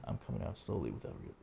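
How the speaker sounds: hushed, fading to a near whisper. Retold or imitated. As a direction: whisper like a kid faking sleep. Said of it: "So I was like".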